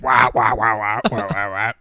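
mock frog babble, trailing down; imitated frog-speak wahs. Frog lady talking to the pilot, imitated wah-wah babble. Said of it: "you don't get any of that".

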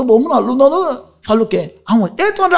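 high, loud refusal. Retold or imitated. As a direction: shout the new price. Conductor raising price.

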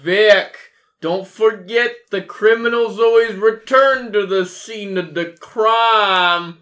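hammed-up shout with a slow finish; in-character yell. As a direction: yell it across to Vic, drawing out the ending. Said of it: "I do yell, actually".

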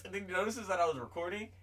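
normal talking voice

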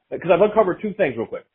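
ordinary talk

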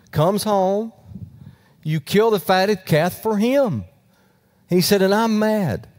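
voce alta e risentita; resentful protest. Retold or imitated. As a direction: sputa fuori la rabbia verso il padre. Older brother's angry complaint.

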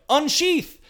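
high, loud game command; game announcer. Retold or imitated. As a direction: bark it like an announcer.

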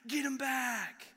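rally the room like a fan cheering on revenge; excited outburst. Retold or imitated. Preacher mimicking viewers cheering on revenge, excited and loud. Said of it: "and I'm like".

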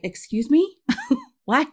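high, rising incredulous questions; retold disbelief. Hearing the man was her friend's dad, mock stunned disbelief. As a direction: react in comic astonishment, pitch shooting up.

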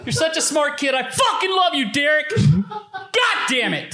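high furious shout through laughter; angry dad yell. Retold or imitated. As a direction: scream the love in fury. Mock dad yelling praise angrily.

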